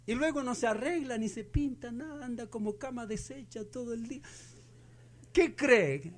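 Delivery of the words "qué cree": ordinary talk, nothing marked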